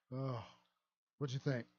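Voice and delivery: normal talking voice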